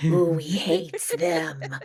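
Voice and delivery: drawn-out, put-on creature voice; Schmeagol. Joking about what husband first named her inner voice, creature impression. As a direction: play a spiteful little creature, slow and hammed-up. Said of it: "at first, he named it Schmeagol".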